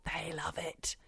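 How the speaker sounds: hushed gossipy whisper. Real, not acted. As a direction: whisper it like a secret. Gossiping about parents quietly.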